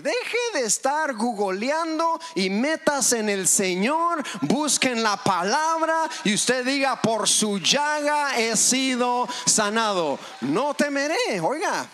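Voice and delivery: loud, slow, lifted exhortation; fiery preaching. Acted. Preacher urging the congregation to declare healing, loud and slow. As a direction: drive the healing verse home.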